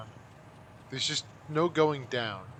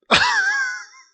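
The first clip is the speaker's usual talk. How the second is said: very high, loud giggle; squeaky laugh; 14 dB louder than usual. Real, not acted. Player still laughing at the death, squeaky laugh.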